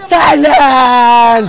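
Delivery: very slow, stretched out; drawn-out silence. Shown as a demonstration. Fan shows how the film word should sound, slow and drawn out. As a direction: draw the word silence out long and heavy. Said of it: "এভাবে হবে".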